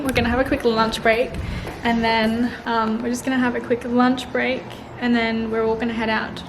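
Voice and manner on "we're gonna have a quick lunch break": ordinary delivery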